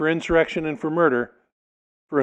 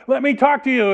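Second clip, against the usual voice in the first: higher and louder, straining to be heard; shouting over a crowd; 10 semitones higher, 5 dB louder than usual. Acted. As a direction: yell to get the mob's attention.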